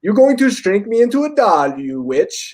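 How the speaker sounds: high-pitched imitated accusation, dropping at the end; Borat. Retelling Borat confronting the garage sale lady, in his voice. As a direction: accuse in a put-on character voice, starting high. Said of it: "and he's like".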